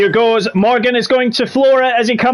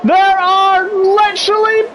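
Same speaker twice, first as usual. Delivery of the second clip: excited race call; 13 semitones higher than usual. Acted. Commentator calling the final lap to viewers, high and excited. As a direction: rise to a frantic finish.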